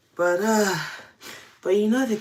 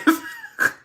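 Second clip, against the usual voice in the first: short squealing laugh, much higher; high squeal of laughter; 5 dB louder than usual. Real, not acted. Squealing laugh over how pricey those calls were.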